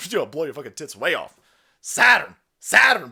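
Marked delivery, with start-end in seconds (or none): 1.8-3.1 Saturn belted out loud; shouted name. Acted. Hosts hyping up the boys, shouting the name loud. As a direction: bellow the brand name like a rally cry.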